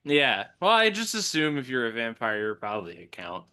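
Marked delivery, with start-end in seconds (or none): none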